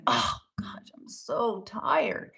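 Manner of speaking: breathy, weary whisper; drained mock whisper; tired whisper. Shown as a demonstration. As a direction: sigh it out like you can barely wake. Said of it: "instead of saying".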